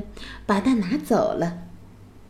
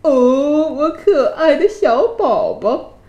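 slow, higher, tender dragon voice; fond roar; 4 semitones higher, 4 dB louder, slower than usual. A narrator's reading. Mother dragon cooing fondly over a baby. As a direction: coo it slowly like a doting mother dragon. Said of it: "美拉斯疼爱地吼叫着".

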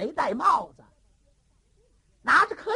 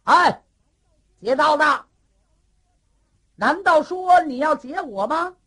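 higher, much louder hail at the robber; loud challenge; 5 semitones higher, 10 dB louder than usual. Acted. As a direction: call out to stop the highwayman. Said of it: "大喊一声". (高声大喊拦路)

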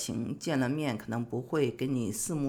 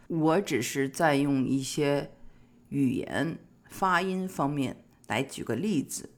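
ordinary delivery

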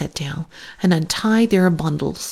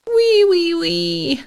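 much higher, squealing piglet giggle; giggling piglet interrupting; 11 semitones higher, slower than usual. Acted. Alexander the piglet interrupts his lecturing mother, squealing. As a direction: cut her off with a silly squeal. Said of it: "interrupted Alexander again".

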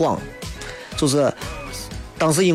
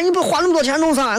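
nagging wife; 10 semitones higher than usual. Retold or imitated. Wife quoted inside the impression.

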